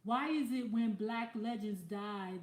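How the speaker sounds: ordinary talk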